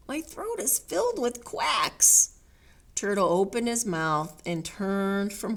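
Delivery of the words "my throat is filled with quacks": higher, put-out cow voice; complaining cow. Acted. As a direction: complain in a raised voice. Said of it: "asked Cow".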